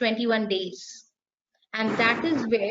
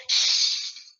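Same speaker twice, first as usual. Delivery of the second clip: a long whispered snake hiss. Shown as a demonstration. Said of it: "When I introduce snake, I go".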